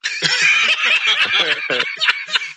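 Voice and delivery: high, loud burst of laughter. Real, not acted. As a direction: let out a big high laugh. Big laugh at joke.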